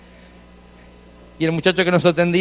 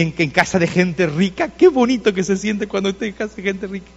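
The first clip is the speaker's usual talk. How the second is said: ordinary delivery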